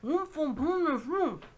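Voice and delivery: loud, high muffled grunting; muffled in hat. Acted. Uncle Andrew answers from inside his hat, muffled. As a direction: talk through a hat jammed over the face. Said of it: "voice from inside the hat".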